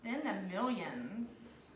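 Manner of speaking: slow, even dictation pace. Acted. Teacher dictating place values slowly to class. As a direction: spell it out slowly so kids can write.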